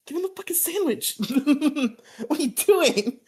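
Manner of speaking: high, loud, incredulous demand; exasperated yell. Retold or imitated. Zach yelling at Bulk to give up the sandwich. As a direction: yell at them to hand it over. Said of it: "Zach's like".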